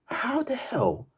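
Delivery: high, slow, breathy 'How the hell'; disbelieving whisper. Real, not acted. Fan replaying the goal, whispering in disbelief.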